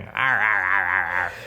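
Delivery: growling arr arr like a gruff sailor; pirate growls. Retold or imitated. Director mocking the actor: gruff growling arrs. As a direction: bark out the arrs in a rough seadog voice. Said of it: "Willem going".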